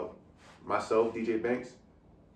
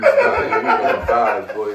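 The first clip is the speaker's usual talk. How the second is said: laughing hype yell; excited shout; 12 dB louder, much slower than usual. Real, not acted. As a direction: burst out with hype.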